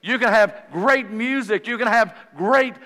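loud, high, driving pulpit delivery; preaching. Real, not acted. Preacher telling congregation what revival needs, forceful preaching. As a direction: preach it out, hammering each repeated phrase.

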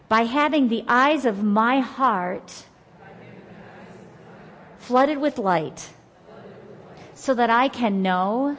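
slow, loud scripture declaration in short phrases; repeat-after-me prayer. Acted. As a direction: lead a group in prayer, pausing after each phrase for them to echo.

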